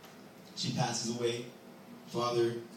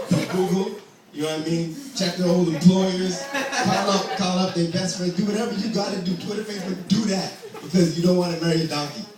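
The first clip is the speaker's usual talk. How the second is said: louder, higher, pushing the advice; emphatic urging; 5 semitones higher, 7 dB louder than usual. Real, not acted. Comedian urging the crowd, emphatic and loud. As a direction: press the point home with a laugh. Comic urging, rising energy.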